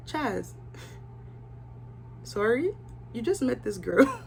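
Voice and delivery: breathy laugh through the words; can't believe it, laugh it out softly; hushed, giggling "you just met her"; laughing disbelief. Real, not acted.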